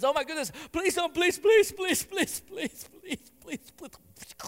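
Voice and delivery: high, frantic pleading that fades to a breathless whisper; scared to death. Pretend. Daniel pleading with the king's guard, terrified. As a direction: beg for your life, then run out of voice. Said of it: "like, scared to death".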